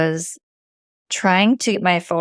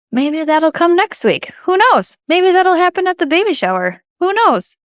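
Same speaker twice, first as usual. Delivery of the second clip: high, loud breezy who knows; carefree; 10 semitones higher, 4 dB louder than usual. Real, not acted. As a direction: toss it off cheerfully. Carefree shrug about photos.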